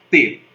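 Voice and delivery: "T" said alone, tongue between teeth; sounding the letter T. Shown as a demonstration. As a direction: demonstrate "T" as a crisp linguodental stop. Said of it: "Podem fazer aí". (pronúncia clara do "T")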